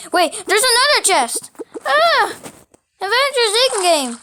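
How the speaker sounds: high, loud thrilled cry; excited shout. Real, not acted. Player thrilled to find another chest, excited shout. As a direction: cheer at the new chest.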